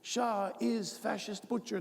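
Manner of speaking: high, drawn-out mock protest chant; imitating screaming protesters. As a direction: put on a high-pitched protester voice, slowly. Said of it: "screaming at the top of their lungs".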